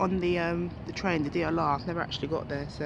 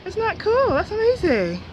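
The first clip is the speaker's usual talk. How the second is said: thrilled and much higher; excited; 12 semitones higher, 5 dB louder than usual. Real, not acted. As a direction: gasp it out in delight. Surprised by view from bridge.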